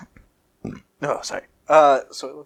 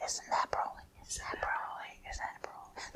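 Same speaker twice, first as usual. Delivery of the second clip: repeated hushed whisper, fading; crowd whisper; 20 dB quieter than usual. Acted. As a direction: murmur it over and over. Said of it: "they all whisper".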